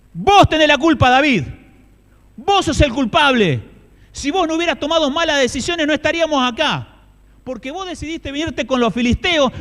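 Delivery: loud, high furious accusation; angry accusing crowd. Acted. Angry people blaming David, loud furious shouting. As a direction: hurl the blame at him, shouting.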